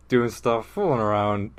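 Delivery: usual speaking voice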